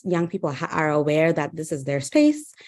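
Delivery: ordinary delivery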